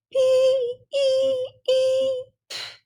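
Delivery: very high thin squeal fizzling out; squeaky trumpet blast. A narrator's reading. Obie's trumpet squeaking then sputtering out. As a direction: squeal like a cracked trumpet note. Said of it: "and Obie went".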